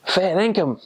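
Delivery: high-pitched Australian twang up in the nose; nasal Aussie accent. Shown as a demonstration. Returned missionary giving accent tips, nasal Australian. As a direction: push it up into the nose, Aussie-style. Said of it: "just kinda get it up in the nose".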